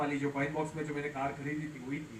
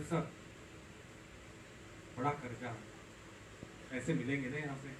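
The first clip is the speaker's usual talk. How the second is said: softer, lower, hesitant plea to the boss; low, soft, nervous request; timid and quiet; 5 semitones lower, 12 dB quieter than usual. Retold or imitated. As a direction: ask meekly, like a nobody begging. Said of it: "अब मैं तो धीरे-धीरे बोल रहा हूँ".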